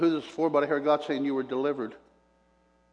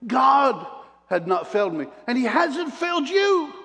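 high, loud and slow pulpit climax; preaching at full force; 9 semitones higher, 3 dB louder than usual. Acted. Preacher assures the congregation, high, loud and slow. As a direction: drive it home to the congregation.

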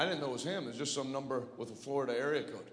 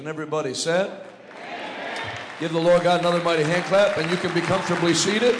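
booming preacher calling the crowd; rally the whole room loudly; loud call to applause; 3 semitones higher, 12 dB louder than usual. Acted. Calls congregation to applaud.

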